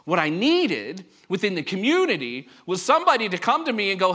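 ordinary talk, nothing marked

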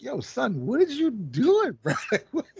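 high, laughing disbelief at a friend; incredulous. Retold or imitated. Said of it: "And I'm like".

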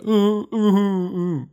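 very high cooing mole noises; Bottles imitation. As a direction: coo like a little mole. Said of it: "Bottles is like".

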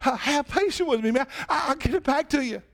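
a mild mock plea; pleading debtor. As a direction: beg fast for time. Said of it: "The man says the same exact words".

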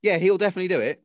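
fast, high breezy promise; mock agent. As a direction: rattle off a hollow promise. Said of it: "and she'll go".

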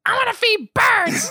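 put-on high, slow, far-off little kid asking sweetly. Podcaster imitating the kid in the movie, high and slow. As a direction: play the small boy who only wants to feed birds. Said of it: "And the kid's just like".

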